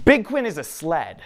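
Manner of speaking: put-on little boy's announcement. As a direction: announce it like an earnest little kid. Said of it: "he says with his, with his big".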